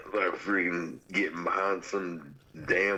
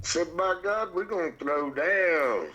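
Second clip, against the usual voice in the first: slow, much higher hammed-up Southern brawler; mock southern threat; 9 semitones higher, slower than usual. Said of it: "I get real southern".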